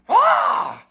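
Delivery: very high loud creature shriek. Acted. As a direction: let out a shrill cry. Cry of frustration.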